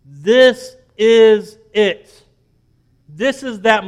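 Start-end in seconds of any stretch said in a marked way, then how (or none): 0.1-2.2 "this is it" drawn out slowly, stress on this; slow down and land "this is it"; slow emphasis. Real, not acted. Preacher revealing history's key moment, slow and weighty. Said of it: "slow emphatic reveal".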